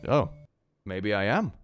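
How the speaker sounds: ordinary delivery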